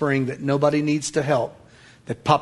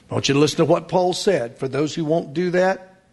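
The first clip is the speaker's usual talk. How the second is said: usual speaking voice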